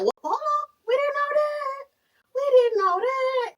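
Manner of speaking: gasping disbelief at the news; shocked crowd. Retold or imitated. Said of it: "And everybody is like".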